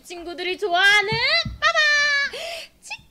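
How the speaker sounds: loud high reveal; booming prize announcer. Real, not acted. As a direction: unveil the prize.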